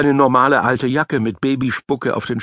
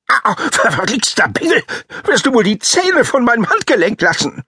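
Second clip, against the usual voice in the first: high, furious character yelling; angry voice; 10 semitones higher than usual. A narrator's reading. Schornstein yelling at the biting boy, high furious voice. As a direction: snap at the brat, high and angry. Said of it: "die wütende Stimme des Schornsteins".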